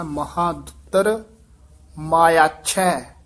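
ਮਾਇਆਛੈ slow and joined as one word; pause, then ਮਾਇਆਛੈ with a firm chhai; careful pronunciation. Shown as a demonstration. Teacher modelling careful reading, ਮਾਇਆਛੈ said slowly as maya-chhai. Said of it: "ਇਹ ਪਾਠ ਧਿਆਨ ਨਾਲ ਉਚਾਰਨ ਕਰਨਾ ਹੈ".

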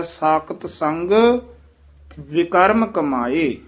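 ਵਿਕਰਮ lifted from the ਵ, slow and clear; careful demonstration. Shown as a demonstration. Teacher slowly models ਵਿਕਰਮ for the pupil. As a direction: say ਵਿਕਰਮ slowly, each sound separate. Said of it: "ਵਾਵੇ ਤੋਂ ਚੱਕ ਕੇ ਉਚਾਰਨ ਕਰਨਾ ਹੈ".

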